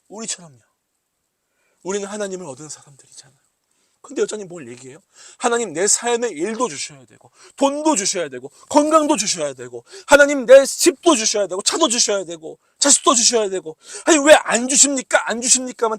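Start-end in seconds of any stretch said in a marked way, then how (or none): 5.4-16.0 mock demanding complaint. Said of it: "따지죠".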